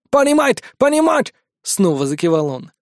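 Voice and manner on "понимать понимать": high, hurried foreigner's yes; eager broken speech. Acted. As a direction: nod along eagerly in bad Russian. Wounded captive nodding eagerly.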